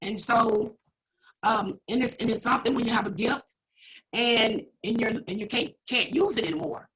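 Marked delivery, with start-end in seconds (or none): none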